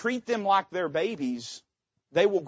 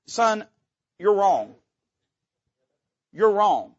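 stern father; 4 semitones higher, 5 dB louder, slower than usual. Acted. Preacher voicing a scolding father.

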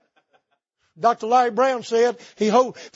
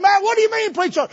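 loud, high call to the crowd; shouted question; 10 semitones higher, 5 dB louder than usual. Acted. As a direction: call it out like a revival preacher. Acted shouted question to congregation.

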